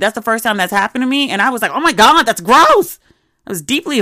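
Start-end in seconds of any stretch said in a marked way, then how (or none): 1.7-3.0 loud, high grossed-out cry; loud high disgusted outburst. Retold or imitated. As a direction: recoil in disgust, voice jumping up. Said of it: "I was, like, absolutely disgusted".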